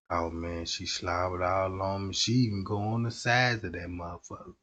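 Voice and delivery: ordinary talk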